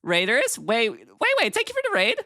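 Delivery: high bright streamer greeting; cute anime streamer. Acted. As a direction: bubbly welcome to raiders. Welcoming raiders.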